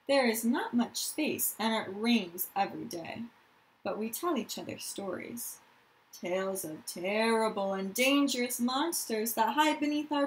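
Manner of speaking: put-on reading aloud to young children; story narration. A narrator's reading. Teacher reads a refugee story to her class, storytelling voice. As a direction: tell the story gently to the class.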